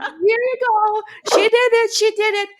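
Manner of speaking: high, gleeful tattling; mischievous grandma. Retold or imitated. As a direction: pin it on her playfully.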